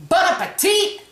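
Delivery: bright high bon appetit; cheerful sign-off. Real, not acted. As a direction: sing out the catchphrase. Sign-off catchphrase.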